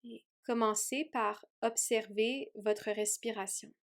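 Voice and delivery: ordinary talk, nothing marked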